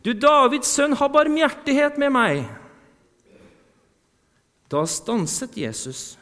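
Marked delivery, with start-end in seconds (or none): 0.1-2.4 loud, high desperate shout; shouting even louder. Acted. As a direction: cry out over the crowd. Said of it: "ropte bare enda høyere".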